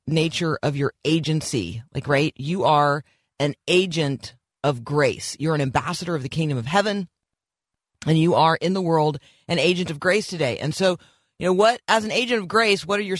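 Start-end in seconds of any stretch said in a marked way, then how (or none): none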